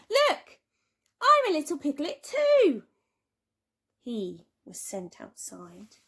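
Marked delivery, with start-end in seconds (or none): 0.1-2.8 put-on high, loud child voice; childish plea. A narrator's reading. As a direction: show off like a piglet. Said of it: "and said".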